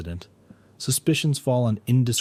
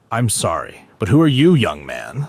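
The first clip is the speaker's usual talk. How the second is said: serious voice; 8 dB louder than usual. A narrator's reading. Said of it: "asked in a serious voice".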